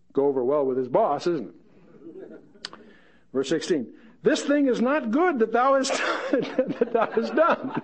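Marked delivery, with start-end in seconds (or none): 4.2-7.8 higher, slow scolding that breaks into a laugh; amused mock scolding. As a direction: scold with a chuckle. David scolds, reader laughs.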